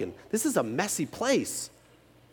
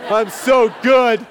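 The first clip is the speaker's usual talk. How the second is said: mock bragging; 7 semitones higher, 8 dB louder, slower than usual. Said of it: "And I'm like".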